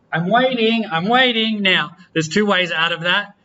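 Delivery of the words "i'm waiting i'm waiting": high-pitched voice of a waiting program; waiting computer. Acted. R prompting the user for more input, high-pitched waiting voice. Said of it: "it's like".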